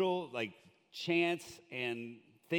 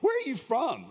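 high, loud disbelief; mock-baffled, much higher; incredulous; 11 semitones higher, 7 dB louder than usual. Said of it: "I was like".

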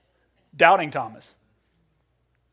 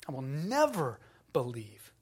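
defiant; 3 semitones lower, 4 dB quieter than usual. Retold or imitated. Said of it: "That's a cry of defiance".

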